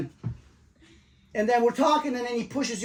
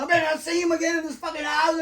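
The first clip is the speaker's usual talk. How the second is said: higher mock tough-guy threat; imitating angry threat; 7 semitones higher than usual. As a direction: bluster it like the roommate. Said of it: "He goes to your mother".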